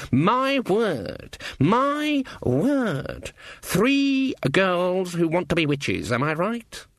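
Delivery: high, drawn-out mock amazement; scornful Granny witch, mock astonished. Old witch sizing up three hopeful girls, mock astonished.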